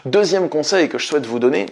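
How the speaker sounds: ordinary delivery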